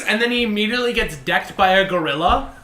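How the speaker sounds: ordinary talk, nothing marked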